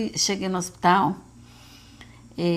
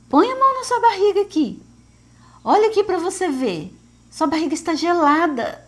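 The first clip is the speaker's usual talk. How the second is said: high, raised-voice urging, retold; espanto recontado; 8 semitones higher, 5 dB louder than usual. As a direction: urge her to check, pitched well up and louder. Mãe mostra o milagre à filha. (mãe empolgada, em tom bem agudo)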